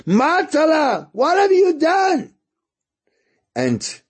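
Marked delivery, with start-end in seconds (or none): 0.1-2.3 put-on high, slow, drawn-out scolding cry at a child; much higher mock scream; mother screaming. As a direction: shriek his name like a horrified mother. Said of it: "she starts screaming to him".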